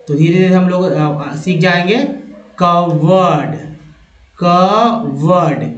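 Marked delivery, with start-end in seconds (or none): 2.6-5.7 कवर्ड stretched out slowly; slow pronunciation demo. Shown as a demonstration. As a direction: drag out कवर्ड to teach it. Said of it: "यही उच्चारण इस word का है".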